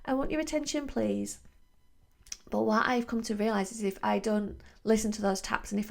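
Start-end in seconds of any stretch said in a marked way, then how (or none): 0.1-1.4 high, small polite voice asking nicely; high polite little voice. Pretend. Body politely asking for attention, high and small. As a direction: squeak it out like a timid little tap. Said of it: "It's like tapping on me going".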